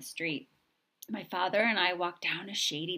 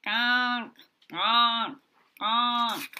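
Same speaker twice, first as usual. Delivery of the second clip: higher, louder gonk; big duck honk; 4 semitones higher, 5 dB louder, much slower than usual. A narrator's reading. Big ducks honking from a distance. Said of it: "The big ducks say".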